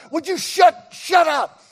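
slow, high shouted inner voice; his own brain yelling at him mid-sentence, slow high shout. Retold or imitated. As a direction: yell at yourself to shut up, drawn out. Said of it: "your brain's going".

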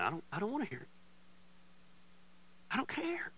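soft, breathy near-whisper of not caring; hushed dismissal. Retold or imitated. Pastor telling friends he won't hear the critics, whispered. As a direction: wave it off under the breath.

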